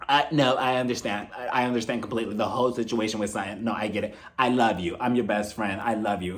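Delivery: low, soft reassurance; mock consoling. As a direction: imitate a best friend soothing someone in tears. Imitates Erica consoling crying Diana.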